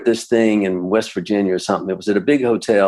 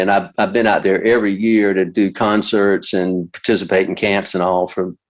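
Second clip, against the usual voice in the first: usual speaking voice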